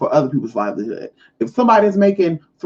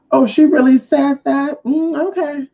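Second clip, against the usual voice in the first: high, drawn-out mock reaction; feigned surprise; 9 semitones higher, 3 dB louder than usual. Hearing messy gossip about herself, feigned surprise. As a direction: act taken aback, pitched up and stretched.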